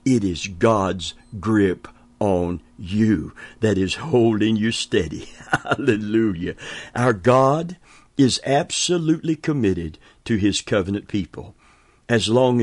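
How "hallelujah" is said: usual speaking voice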